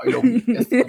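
plain reading voice

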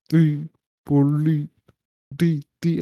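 very slow, drawn-out, weird chant. Shown as a demonstration. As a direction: stretch it out slowly and weirdly. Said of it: "Just slow it down, make it all weird".

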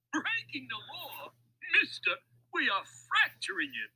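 high-pitched crook voice, mocking; amused crook. A narrator's reading. Louis jokes back at Mac's warning, amused crook. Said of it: "Louis laughed".

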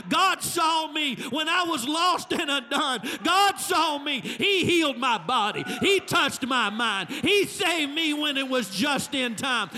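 high, loud preaching shout; full-force Pentecostal preaching climax; shouting preacher. Acted. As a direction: bring the church to its feet.

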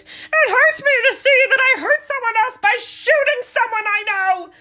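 fake-cry it like a self-pitying man; mock male tears. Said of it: "with those male Karen tears".

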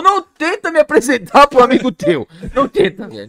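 loud, high-pitched comic warning; exasperated plea. Real, not acted. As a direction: plead loudly, fed up. Exasperated plea to those introducing him. (apelo exasperado em voz alta)

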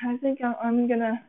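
ordinary delivery

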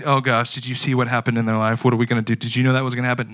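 loud, rushed mock panic; panicky worried chatter. Preacher mocking a panicked believer, loud alarm. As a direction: fuss loudly like frightened friends. Frantic people in a crisis.

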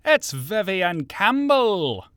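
slow, loud ring announcer. Acted. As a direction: boom out the name like a ring announcer. Announcer introduction.

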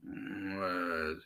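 low, soft groggy mumble; sleepy groan. Retold or imitated. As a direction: imitate a mom too sleepy to answer. Mom still half asleep.

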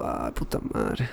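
low, slow, very soft mutter; muttered curse. Retold or imitated. Woman answering his confession, retold as a low muttered curse. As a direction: mutter the swear under your breath. Retells her reply as a weary curse.

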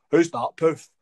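loud imitated cinema heckler; mock yob calling out; sudden heckle. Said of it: "just suddenly goes".

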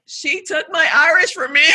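loud, laughing mock complaint; laughing mock lament. Joking about the stolen bartender, loud laughing lament. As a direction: wail it half-laughing.

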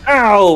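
mock yelp of pain. Real, not acted. Player reacts to accusation.

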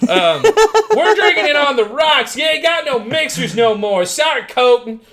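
put-on high, hollering country accent; hammed-up rural American accent, building to a shout; Ford Ranger guy. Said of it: "the person I'm thinking of is the Ford Ranger guy".

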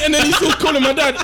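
usual speaking voice

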